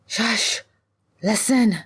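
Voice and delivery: high, sharp shush; urgent hushing. Acted. Ziba silences her friend while fleeing, urgent.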